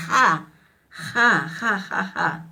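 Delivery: ja with a strong outbreath; aspirated ja. Shown as a demonstration. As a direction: breathe hard on ja. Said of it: "pronunciar la ja".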